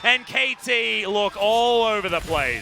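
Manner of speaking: high, excited esports caster; excited play-by-play. Real, not acted. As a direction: call the kill live, drawing out the end. Live call of a kill.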